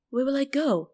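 high-pitched helpless question; mock forlorn plea. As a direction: imitate a kid turned out. Retold boy turned away by grandmother.

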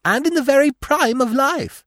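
high, loud wail of a lament. A narrator's reading. Squirrel mourning the dead prince, lamenting. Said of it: "the squirrel lamented".

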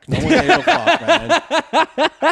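high, loud, slow laughing rant; laughing disbelief. Real, not acted. Friend mocks fear of crack, high laughing disbelief.

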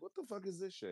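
baffled outrage at the commercial break; indignant disbelief. Retold or imitated. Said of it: "I'm like".

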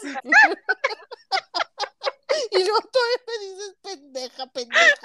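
high, laughing protest. Real, not acted. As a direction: squeal it through laughter. Laughing back at insult.

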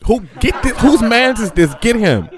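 high, loud mock calling-out; outraged partygoer. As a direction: holler for someone to remove the embarrassing drunk. Imagined guests calling out about him.

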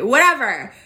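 ordinary delivery

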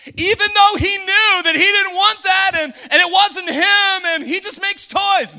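loud, high preacher's shout; preaching at full force. Acted. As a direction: shout it over the congregation.

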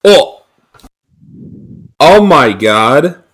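real shock turning to laughter; surprised. Real, not acted. Discovering the Mario show.

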